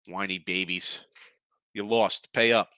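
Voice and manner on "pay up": a firm first demand; firm pay up. Real, not acted. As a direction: pay up, said firmly and a bit louder. Scolding bettors to pay.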